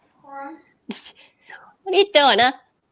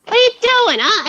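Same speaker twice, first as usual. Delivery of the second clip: high sing-song voice to the dog; dog talk; 11 semitones higher than usual. Real, not acted. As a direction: coo at the pet, pitched way up. Talking to the dogs.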